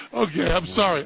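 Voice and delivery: ordinary delivery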